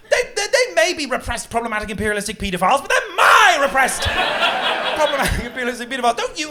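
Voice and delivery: high, loud, outraged shouting; indignant yelling tirade at door-knockers; furious rant. Retold or imitated. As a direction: rant it out in mock fury. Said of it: "The media was like".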